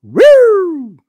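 high whooping siren sound. Retold or imitated. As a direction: mimic a police siren rising high. Sirens arriving.

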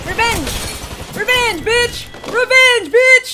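triumphant shout. Real, not acted. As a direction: scream it after getting payback. Gets the kill back in game.

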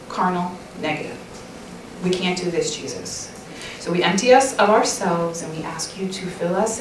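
soft, unhurried prayer voice; closing prayer. Real, not acted. Speaker praying aloud over the ladies, soft and earnest. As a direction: pray it gently over the listeners.

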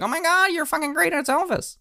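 high squealing fan voice; gushing fan. Retold or imitated. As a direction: gush over him like a starstruck fan. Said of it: "everyone's like".

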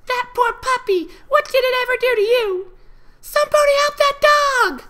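put-on high, loud outraged child voices; young foals yelling in outrage; angry filly shout. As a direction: shout it like a furious little filly.

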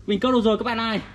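ordinary delivery

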